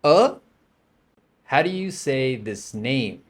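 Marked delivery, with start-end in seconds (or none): 0.0-0.4 vowel â said high and clear; Vietnamese vowel demo. Shown as a demonstration. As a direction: model the vowel for students to copy. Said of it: "what's this vowel".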